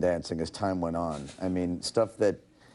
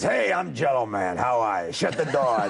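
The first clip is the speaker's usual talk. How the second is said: put-on vagrant character voice; Jell-O Man; 2 semitones higher than usual. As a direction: play a cartoon vagrant living in a fridge. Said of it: "So I did a voice like this".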